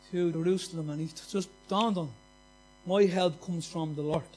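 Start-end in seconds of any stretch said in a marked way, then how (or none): 2.8-4.2 slow, weighty scripture reading; preaching. Acted. Preacher to the church, declaring the verse slowly. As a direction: lean on each word of the verse.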